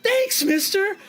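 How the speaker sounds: put-on high, loud little-boy voice; Topher. Little boy thanks the criminal, high and loud. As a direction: pipe up as an excited small kid. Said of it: "Topher looks up and he says".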